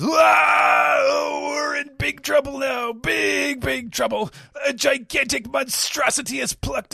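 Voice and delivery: very high, loud panicked squawking; over-the-top cowardly wail, very extra; Caribou voice. Acted. Caribou begs Devon and Pizarro for rescue, high panicked shouting. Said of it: "Caribou says".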